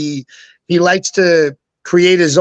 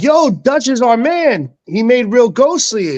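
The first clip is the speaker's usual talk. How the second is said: higher-pitched imitation of hyped friends; friends vouching; 7 semitones higher than usual. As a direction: talk him up like an eager buddy, pitched up. Friends vouching at the juice bar.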